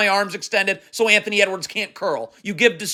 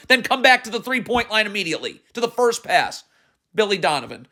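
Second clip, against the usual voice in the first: normal talking voice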